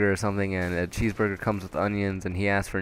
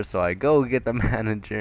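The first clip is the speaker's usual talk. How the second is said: usual speaking voice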